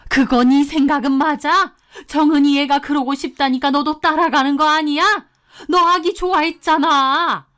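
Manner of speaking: high-pitched, insistent questioning; heated maternal reproach; accusing mother-in-law. A narrator's reading. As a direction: press your son accusingly. Mother-in-law presses her son.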